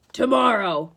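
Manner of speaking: loud monster order; monster ultimatum. A narrator's reading. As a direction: decree it firmly as a monster. Cyclops sets a deadline.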